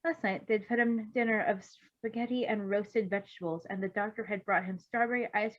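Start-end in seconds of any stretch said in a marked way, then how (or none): none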